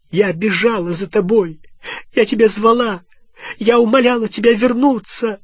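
high, loud, building reproach; anguished accusation. Acted. Hermione reproaching Ron who left her, impassioned yelling. Гермиона обвиняет ушедшего Рона.

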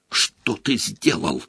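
slow, hushed outrage; furious whisper. A narrator's reading. Hermione confronting Harry in hiding, furiously whispering. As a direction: scold under the breath, drawing the words out. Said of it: "яростно прошептала она".

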